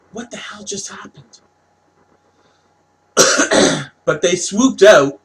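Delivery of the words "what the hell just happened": high, very soft disbelief; retold bewilderment. Recalling what just swooped past, hushed bewilderment. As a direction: ask it small and high, stunned. Retelling own startled reaction.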